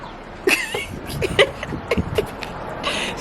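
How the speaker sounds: high laugh. Real, not acted. Friend says she's being scammed.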